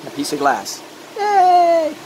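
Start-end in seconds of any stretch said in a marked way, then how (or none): 1.2-2.0 high, loud little yay; excited cheer. Real, not acted. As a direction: cheer it out, delighted. Celebrates finishing a glass piece.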